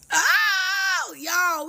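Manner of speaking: high-pitched, drawn-out exclamation; gleeful high cry to friends; excited outburst. Acted. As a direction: burst out high with delighted discovery. Excited about new travel destination.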